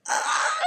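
very high, loud wordless "ah"; exasperated cry. Retold or imitated. Overwhelmed mom after being yelled at, exasperated cry. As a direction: let out a re-enacted squeal of exasperation. Exasperated mom's reaction retold.

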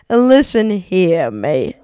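very slow, deliberate put-down; stern rep. Pretend. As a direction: spell out the insult slowly. Rep turns on the customer.

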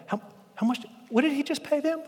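high, soft, breathy disbelief; astonished whisper. Retold or imitated. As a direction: gasp it out from the back of the line. Workers peering at the payouts.

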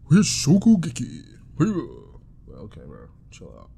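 loud, mock-intense anime shout; anime voice acting. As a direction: blast it out like an over-intense anime character. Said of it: "Everybody got a mad deep voice".

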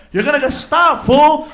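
loud scolding shout; yelling warning. Retold or imitated. Felipe yelling a warning at the driver, loud.

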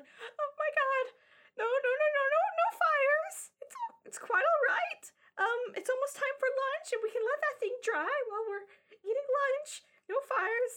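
very high, flustered squeaky voice; Strawberry. Acted. Strawberry stops kids making fires, high flustered voice. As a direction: rush in alarmed, then hastily reassure. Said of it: "She's like".

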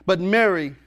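preacher reading scripture at full voice; preaching scripture. Acted. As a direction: proclaim the verses to the congregation. Preacher reads scripture aloud.

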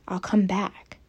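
unvoiced hushed reply; whispering. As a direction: breathe it out in a whisper. Character's soft reply in scene.

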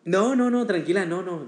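high, loud, quick-to-reassure protest; emphatic reassurance. Real, not acted. Guest reassuring the host who just apologized, emphatic reassurance. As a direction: burst in high and loud to wave away worry.

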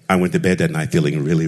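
ordinary delivery

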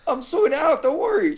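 high-pitched retold reassurance; mock flustered, pitched well up; exasperated reassurance. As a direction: imitate fending off a nagging grandma. Retells fobbing off shouting grandma.

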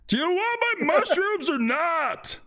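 mock the customers from below; high-voiced shopkeeper snarking at lazy buyers; grumpy cave merchant. Merchant voice again.

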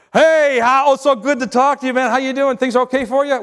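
overfriendly caller laying it on thick; gushing caller. Retold or imitated. Overly familiar caller acted.

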